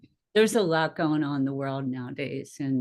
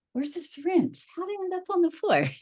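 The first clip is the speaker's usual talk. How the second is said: high, fast bewildered questions; baffled and rushing, way up high; confused; 13 semitones higher than usual. Retold or imitated. Nurse losing grip on a syringe, high bewildered. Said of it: "I'd be like".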